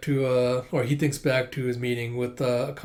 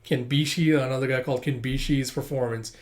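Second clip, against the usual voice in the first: ordinary talk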